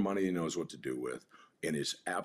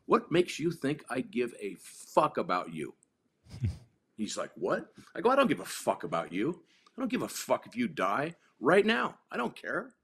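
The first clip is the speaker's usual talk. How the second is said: blunt; 6 semitones higher than usual. Retold or imitated. Bluntly dismissing the kid.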